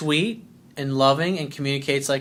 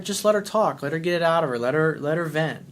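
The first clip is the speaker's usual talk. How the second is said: ordinary talk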